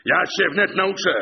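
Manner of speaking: high, loud threat; furious yell. A narrator's reading. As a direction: shout it as an angry warning. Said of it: "wrzasnął klucznik".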